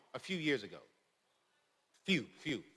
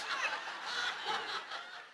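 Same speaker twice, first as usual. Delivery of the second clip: high laugh; 9 dB quieter than usual. Real, not acted. Laughs after line 73.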